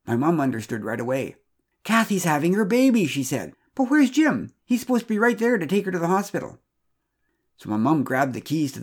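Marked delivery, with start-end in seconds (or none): 1.8-3.1 high, louder burst of alarm; storyteller's excited mom; urgent exclamation. A narrator's reading. Mom realises the birth has started. 3.8-6.6 high, urgent questioning; storyteller voicing a worried outburst; alarmed mom. A narrator's reading. Mom alarmed that husband is missing.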